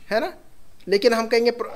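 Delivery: usual speaking voice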